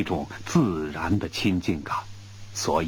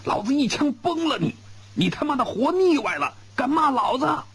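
high, furious bellow; the general threatens to shoot him; roaring threat. A narrator's reading. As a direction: shout it, much higher. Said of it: "我吼道".

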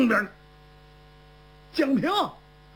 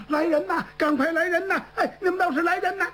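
calling for help; 7 semitones higher than usual. Acted. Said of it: "江平赶快就喊".

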